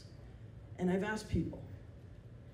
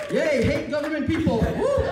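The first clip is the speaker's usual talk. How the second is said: high loud whoop; rally the crowd with a fake cheer; mock cheer; 7 semitones higher, 9 dB louder than usual. Comic joking to the audience, a hammed-up high cheer. Cheering joke at government workers.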